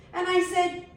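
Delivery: usual speaking voice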